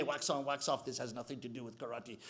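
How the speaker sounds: low, very soft grumble to oneself; quiet, dejected complaint under the breath; grumbling aside. Acted. Student doing the chore for a day, low muted grumbling.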